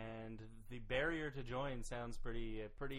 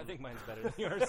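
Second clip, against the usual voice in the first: louder, laughing through the boast; laughing brag; 5 semitones higher, 7 dB louder than usual. Real, not acted. Co-host laughing while claiming his Aussie accent wins. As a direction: tease him with a laugh.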